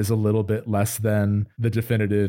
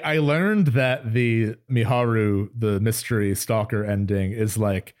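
usual speaking voice

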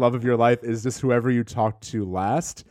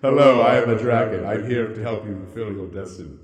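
dragon voice; 2 semitones lower than usual. Retold or imitated. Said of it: "he's like".

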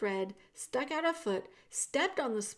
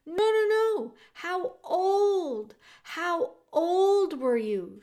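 loud, much higher, slowed shout; 9 semitones higher, 7 dB louder, much slower than usual. A narrator's reading. Princess shouting her question to deaf Meg. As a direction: raise your voice so she hears. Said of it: "shouted the P".

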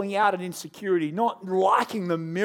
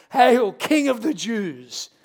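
louder, very slow sarcastic salute; mocking hail; 4 semitones higher, 5 dB louder, much slower than usual. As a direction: sneer it like a false tribute. Soldiers mocking Jesus.

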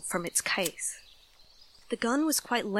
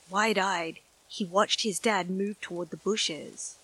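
ordinary delivery, nothing marked

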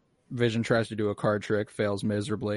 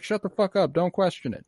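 mock scolding; 8 semitones higher than usual. Audience member silencing someone questioning the trick, mock scolding. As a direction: imitate an annoyed heckler shutting someone down.

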